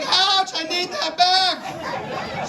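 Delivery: high, slow strained yelp of pain; pained squeal. Retold or imitated. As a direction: stretch it out high like it hurts. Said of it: "I was like".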